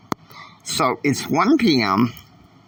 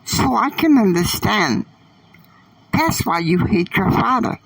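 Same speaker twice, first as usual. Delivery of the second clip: put-on much higher teen girl voice, confiding; 10 semitones higher than usual. A narrator's reading. Annie telling Catalina about her mother, high girlish voice. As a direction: pitch up and tell it like a young girl.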